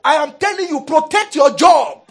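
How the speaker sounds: high, loud, forceful warning; area manager. Retold or imitated. Area manager warning staff after firing a colleague, loud stern threat. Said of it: "My area manager said".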